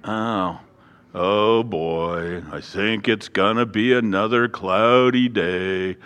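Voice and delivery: slow, low mopey donkey drone; a mopey cartoon donkey, slow and low; Eeyore voice. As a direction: sigh it out like a gloomy Eeyore. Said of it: "[name] is Eeyore".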